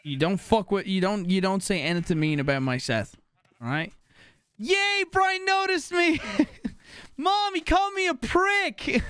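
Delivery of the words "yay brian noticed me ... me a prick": put-on high squeal of a thrilled little kid; squeaky kid. Playing a kid thrilled that Brian noticed, high squeaky squeal. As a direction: squeak it out like an overexcited child, slowly.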